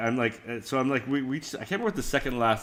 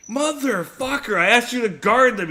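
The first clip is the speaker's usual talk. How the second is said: much higher, louder outraged yell; mock outrage; 13 semitones higher, 8 dB louder than usual. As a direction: blow up at the friend in mock fury. Outraged at friend eating food.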